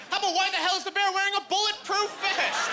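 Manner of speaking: very high, incredulous outburst; mock indignation. Student questioning a physics problem, indignant disbelief. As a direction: pitch shoots up and stays there in comic exasperation. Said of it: "I got kinda mad about it".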